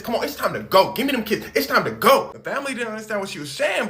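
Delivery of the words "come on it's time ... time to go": loud and higher, pushy commands; aggressive demand. Retold or imitated. Lindsay demanding the refugee mother's kids, aggressive and loud. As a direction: bark it at the mother. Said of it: "getting aggressive".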